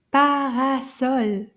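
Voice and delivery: slow, loud "Parasol" in three beats; three-syllable demo. Shown as a demonstration. Teacher showing children three syllables, slow and emphatic. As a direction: beat out "Parasol" for the class. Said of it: "I can hear three".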